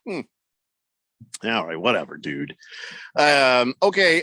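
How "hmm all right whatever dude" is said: shrugging it off. Retold or imitated. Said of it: "And I'm like".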